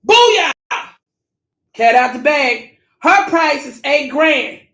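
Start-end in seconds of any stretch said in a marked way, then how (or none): 0.1-0.5 triumphant shout. Real, not acted. Catching her slip-up.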